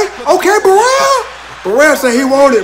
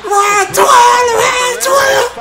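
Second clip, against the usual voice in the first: excited shouting; 7 semitones higher, much slower than usual. Real, not acted. Hyped fan chanting final round.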